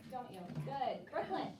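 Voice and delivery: normal talking voice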